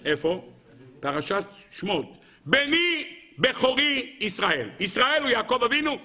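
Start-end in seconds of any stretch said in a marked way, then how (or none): 2.5-4.7 slow, high, word-by-word declamation; emphatic proclamation. Acted. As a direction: stretch each word out like a solemn decree. Proclaims the sealing verse.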